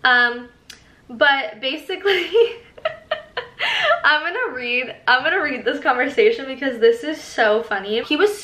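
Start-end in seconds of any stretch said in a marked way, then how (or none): 2.3-4.0 sudden high laugh bursting out; high burst of laughter. Real, not acted. Laughing at her ex-sub's old messages, high burst of laughter. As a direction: crack up at the memory of his texts.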